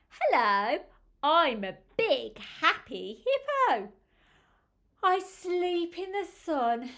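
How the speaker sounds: happy hippo. Acted. Reader speaks as the happy hippo.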